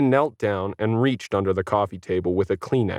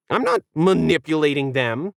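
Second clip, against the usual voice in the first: a touch of a defensive yip; protest, lightly; mild defensive denial; 7 semitones higher than usual. A narrator's reading. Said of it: "Planet yipped defensively".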